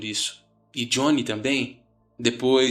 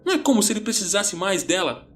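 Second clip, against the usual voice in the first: high, agitated panicked rant; teenage friend's hysterical protest, pitched up; frenzied; 6 semitones higher than usual. A narrator's reading. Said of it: "Ele estava entrando em frenesi".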